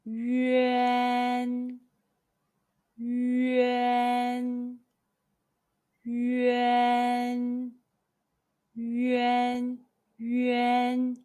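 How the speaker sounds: "yuan" said slowly and softly, a pause after each, for the learner to copy; "yuan" repeated softly as a model for repetition. Shown as a demonstration. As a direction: model "yuan" carefully, one at a time. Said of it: "mimic the mouth shape in the video carefully and repeat after it".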